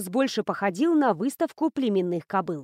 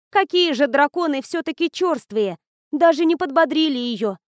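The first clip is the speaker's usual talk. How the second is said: loud, higher, outraged; indignant; 4 semitones higher, 5 dB louder than usual. Acted. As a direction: blurt out indignation. Miriel indignant about dragons.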